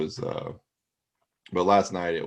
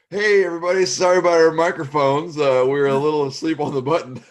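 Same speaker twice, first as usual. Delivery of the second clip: hammed-up announcer voice, much higher and louder; arena announcer; 10 semitones higher, 12 dB louder than usual. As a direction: play the embarrassed arena PA guy apologizing. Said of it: "that's who goes".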